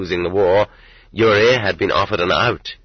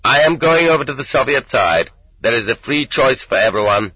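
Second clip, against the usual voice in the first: higher-pitched announcement to the ranks; mildly raised declaration, easing toward the end; declaring; 5 semitones higher, 3 dB louder than usual. A narrator's reading. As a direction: declare it to a line of men. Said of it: "and declared".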